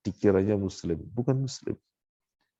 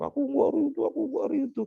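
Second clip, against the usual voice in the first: mock wailing cry; faster than usual. Preacher mimicking a mourner refusing fate, high wail. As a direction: imitate a mourner crying out in protest. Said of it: "Teriak-teriak".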